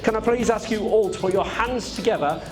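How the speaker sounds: ordinary talk, nothing marked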